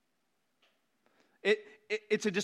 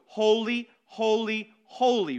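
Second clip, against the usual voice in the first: "Holy, holy, holy" each hit louder, one by one; emphatic repetition; 4 dB louder, slower than usual. Shown as a demonstration. Preacher demonstrating emphasis with "Holy, holy, holy". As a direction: punch every "holy" as a separate beat.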